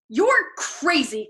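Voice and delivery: slow, loud tearful yell; vehement shout. A narrator's reading. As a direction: hurl it at him near tears. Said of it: "shouted vehemently".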